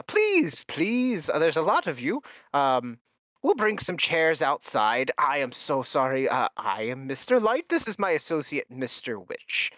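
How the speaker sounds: high, flustered and eager; fussy host voice. Acted. Host apologising to guests, high and flustered.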